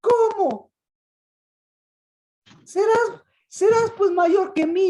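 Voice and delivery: high, loud jealous protest from Peter; preacher voicing Peter, offended at being passed over; indignant Peter. Peter complaining to Jesus, loud, high and indignant. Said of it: "el Pedro está ahí".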